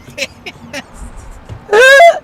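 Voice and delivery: high-pitched rising laugh; bewildered laugh. Real, not acted. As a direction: burst into a high, helpless squeal of laughter. Bewildered by the changed AI.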